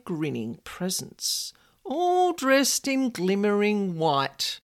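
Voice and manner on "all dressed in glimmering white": ordinary delivery, nothing marked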